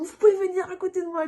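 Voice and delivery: high, fast urgent request; urgent plea. Retold or imitated. Mother asking the midwife to come close, high and fast. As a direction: ask the midwife quickly.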